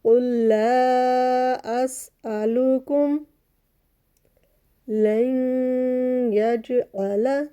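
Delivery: very slow chanted recitation; tajweed recitation. Shown as a demonstration. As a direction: hold each vowel long as in Quran reading. Recited verse.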